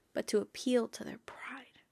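whispered aside, almost secretive. Real, not acted. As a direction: drop to a breathy whisper for the point. Whispered aside about pride.

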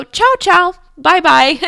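Italian goodbye. Retold or imitated. Italian couple cheerily waving the tourist off the train. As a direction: wave her off cheerily, Italian style. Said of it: "in Italian".

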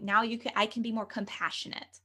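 normal talking voice

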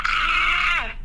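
very high, loud fake scream; mock shriek. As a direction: shriek at the snake, hammed-up. Snake head pops out.

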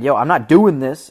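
a loud, stubborn no; mock refusal. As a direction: push back hard as if quoting her. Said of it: "Emma Stone's like".

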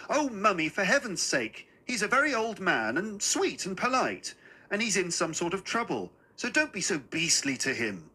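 put-on earnest, high-pitched child's protest; embarrassed daughter, pleading. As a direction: plead with mother to be kinder. Girl's imagined appeal to mother.

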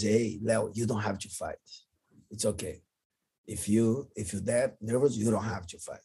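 put-on calm the nervous kid down softly; gentle reassurance. Professor comforting crying student.

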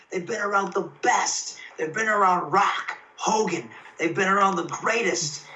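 real excitement rising as he tells it; thrilled. Real, not acted. Wrestler telling the host about praise from veterans, genuinely thrilled. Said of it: "right now I have goosebumps".

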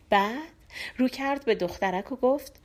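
usual speaking voice

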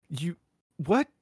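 high, baffled mock question; simply confused. Guy who can't compute it, confused. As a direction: act out total incomprehension. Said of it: "from angry to just simply confused".

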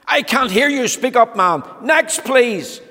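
high, loud dismissive bellow; bellowing grocer. Acted. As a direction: boom it and wave him off.

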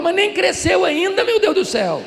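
loud, high mock exasperation; comic disbelief at a worried mother; incredulous. Acted incredulous reply to mother. (incredulidade exasperada, encenada em voz alta)

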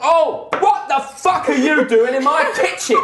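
high, loud roar at intruders; furious half-orc chef yelling; outraged chef. Acted. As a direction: explode at strangers in your kitchen. Chef finds intruders in his kitchen.

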